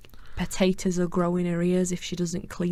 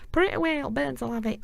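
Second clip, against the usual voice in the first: scolding parent; 7 semitones higher, faster than usual. Retold or imitated. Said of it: "you'd hear a parent go like".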